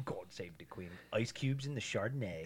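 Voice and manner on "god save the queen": soft, lower, laughing repeat; chuckling quiet echo of the toast. Real, not acted. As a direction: giggle it back under your breath.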